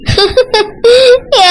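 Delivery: tearful sobbing burst; crying. Acted. Wife breaks down as husband asks what happened, sobbing. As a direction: sob before being able to answer. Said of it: "எதுக்கு அழுதுட்டு இருக்கா".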